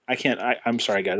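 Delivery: ordinary delivery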